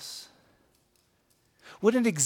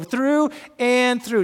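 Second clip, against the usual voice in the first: through and through slow, higher and louder; emphatic through and through; 6 semitones higher, 7 dB louder, much slower than usual. Shown as a demonstration. Pastor showing how he says the verse, emphatic and slow. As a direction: stretch out through and through. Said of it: "I'm gonna go".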